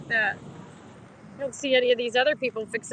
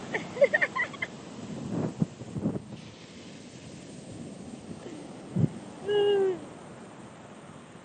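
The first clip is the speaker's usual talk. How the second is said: breathless laugh; 7 semitones higher, 3 dB quieter than usual. Real, not acted. Laughing in cold water.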